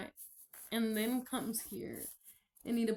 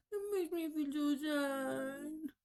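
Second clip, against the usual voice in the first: crying; 5 semitones higher than usual. Real, not acted. Confessing to viewers she felt like a hypocrite, crying. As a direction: speak through tears. Says it makes her sad.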